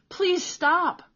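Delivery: high, loud, strained begging; desperate gasping plea. A narrator's reading. Beaten boy begging Peter to stop, high desperate cry. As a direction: beg through the blows. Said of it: "Cole gasped".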